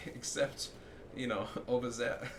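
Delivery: breaking up mid-sentence; amused, laughter between the words; laughing. Real, not acted. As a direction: chuckle through the joke. Cracks up at own bit.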